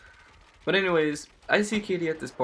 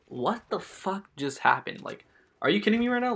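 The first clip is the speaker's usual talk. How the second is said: mock incredulous, rising much higher at the end; retold disbelief; 6 semitones higher than usual. Retold shocked reaction to being sprayed.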